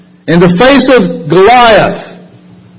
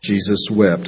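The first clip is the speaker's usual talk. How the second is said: hushed reverence; 11 semitones lower, 14 dB quieter than usual. Acted. Shortest verse, hushed repetition.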